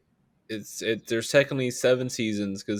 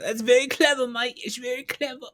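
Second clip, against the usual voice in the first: much higher mock-impressed voice; sarcastic praise; 12 semitones higher than usual. Mocking a movie pun as a kid, in a high mock-impressed voice. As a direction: fake admiration, high and sing-song. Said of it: "I was like".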